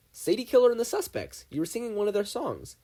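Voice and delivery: high, loud eager naming of the band; excited. Acted. Wendy excitedly telling Stan about the band, excited. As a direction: blurt it out, thrilled to recognize it.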